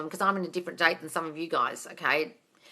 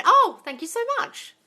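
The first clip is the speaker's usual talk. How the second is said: touched thanks; 4 dB louder, slower than usual. Real, not acted. Compliment on her voice.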